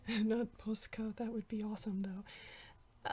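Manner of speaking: soft unvoiced aside, half to herself; whispered aside. Real, not acted. Artist whispering a joke aside to viewers.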